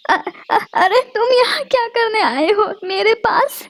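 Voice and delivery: stammering, voice cracking with fear; a small bird trembling before the kite; frightened stammer. Acted. Sparrow stammers in fear at the hawk, shaky. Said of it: "डरते हुए कहती है चिड़िया".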